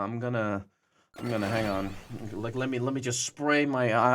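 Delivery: usual speaking voice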